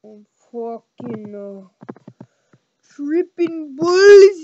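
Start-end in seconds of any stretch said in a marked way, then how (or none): 2.9-4.4 slow, loud drawn-out line; loud slow drawl. Pretend. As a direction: stretch it out loud.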